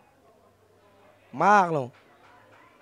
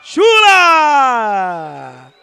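explosive goal commentary; 6 semitones higher, 8 dB louder, much slower than usual. Real, not acted. Goal celebration.

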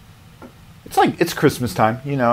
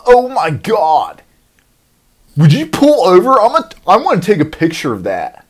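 louder and higher, thrilled tourist; excited gushing; 7 semitones higher, 7 dB louder than usual. Retold or imitated. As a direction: beg the driver to pull over, giddy. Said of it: "everyone's like".

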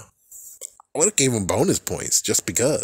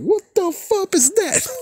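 mock outraged shriek. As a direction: shriek at a Giratina you never knew existed. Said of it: "It's like".

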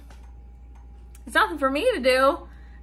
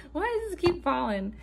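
ordinary talk

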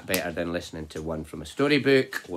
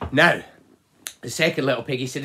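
ordinary delivery, nothing marked

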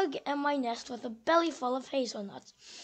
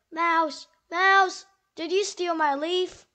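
calling out; 3 semitones higher, 8 dB louder than usual. Acted. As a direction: call out and demand an answer. Squirrel calls and accuses Mouse.

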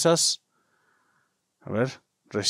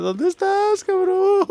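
squeaky high-pitched call; slower than usual. Real, not acted. Gamer hunting a ghost, calling out in a squeaky voice. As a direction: voice jumps way up.

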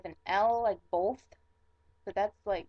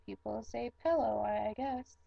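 ordinary talk, nothing marked

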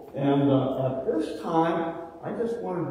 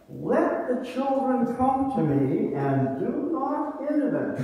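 Jesus' words, lifted higher with a gentle laugh; voicing Jesus; 8 semitones higher than usual. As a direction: give Jesus' invitation warmly to the children. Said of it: "and he said to them".

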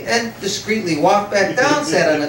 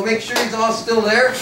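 ordinary talk

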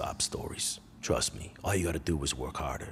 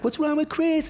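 put-on very high, loud kid voices; shrill alarmed kids calling; kids' voices; 8 dB louder than usual. Kids at the pool call out about Chris, very high and loud. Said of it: "the kids were like".

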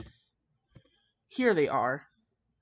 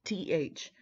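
normal talking voice

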